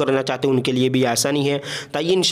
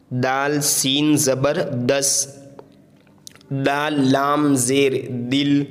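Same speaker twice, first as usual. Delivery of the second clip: letters named slowly one by one; slow patient spelling drill; slower than usual. Shown as a demonstration. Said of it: "हिज्जे करके पढ़े".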